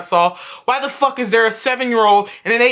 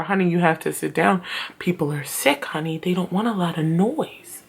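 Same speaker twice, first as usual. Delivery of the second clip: put-on softer, lower hushed plea to a child; hushed mom; 5 semitones lower, 7 dB quieter than usual. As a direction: keep it down like a doctor's waiting room. Mom hushing daughter at doctor's.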